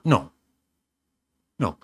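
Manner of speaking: ordinary delivery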